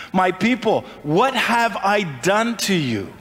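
slow, lightly voiced God asking his people; a hint of God's voice, slow and searching; God pleading. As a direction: draw each question out slowly, like a hurt father. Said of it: "This is God talking".

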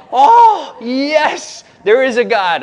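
high, loud joyful cry of relief; overjoyed shout. Retold or imitated. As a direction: burst out like a crowd that just got good news. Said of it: "All the dudes were like".

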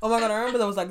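normal talking voice

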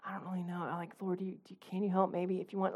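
very soft, faltering plea; timid prayer. Acted. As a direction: mumble a hesitant, apologetic request. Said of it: "in this timid approach where we're like".

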